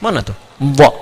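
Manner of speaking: ordinary talk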